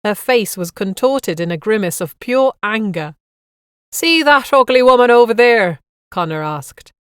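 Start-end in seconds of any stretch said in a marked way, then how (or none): 3.9-5.8 loud, high broad Irish tease; Irish guide joke. A narrator's reading. Guide teasing passengers about the fishwife, loud Irish accent. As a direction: call it out in broad Irish over the mic. Guide jokes over the mic.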